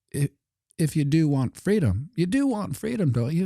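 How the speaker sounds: high, wheedling push on do; mock wheedling official; high coaxing official. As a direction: coax like a smug official.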